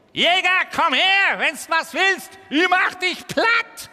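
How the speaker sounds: high, loud tough-guy taunt; cocky little beetle. Acted. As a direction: squeak out a brave threat. Beetle challenges the hunter.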